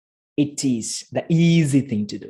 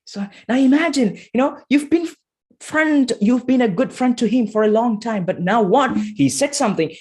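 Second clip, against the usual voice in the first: excited; 9 semitones higher than usual. Real, not acted. Excited high rhetorical build.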